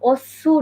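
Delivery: "ওসু" with the u clearly voiced and stressed; wrong full su. Shown as a demonstration. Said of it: "ওটা আসলে উজ্জ থাকবে".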